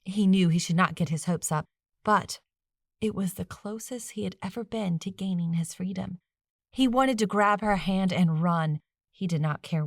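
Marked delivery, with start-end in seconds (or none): none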